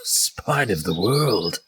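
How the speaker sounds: half-orc amused by the stranger's origin; half-orc, curious. Acted. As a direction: savour the words as the half-orc.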